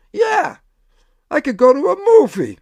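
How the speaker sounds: a happy flash of an idea, lit up; sudden bright idea. Retold or imitated. Sudden idea after laughing.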